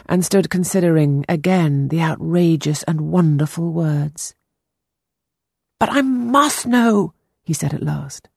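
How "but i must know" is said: put-on higher, earnest young boy voice. A narrator's reading. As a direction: play the anxious ten-year-old boy. Boy Peter voiced in story.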